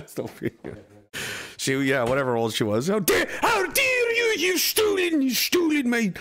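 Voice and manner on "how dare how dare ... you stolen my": shrieking, very high mock outrage; hammed-up scolding shout far above your own voice; Greta. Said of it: "Let's hear the Greta impression".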